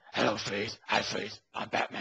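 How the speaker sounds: gruff, growling superhero voice; mock-menacing gruff growl. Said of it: "Kristen Bale's gruff voice".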